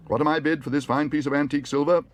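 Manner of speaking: high, loud auctioneer's patter. A narrator's reading. Auctioneer offering antique silver, calling out. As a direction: call for bids on a prize lot.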